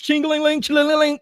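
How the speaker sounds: high, sing-song ching-a-ling like a slot machine; mock the clink of pocket change; tinkly mocking coin jingle; jingling coin noise.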